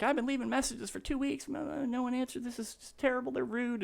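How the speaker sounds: high, mocking whine of a moaning manager; whiny complaining boss. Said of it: "He's like".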